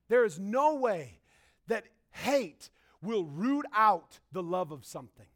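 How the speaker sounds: ordinary talk, nothing marked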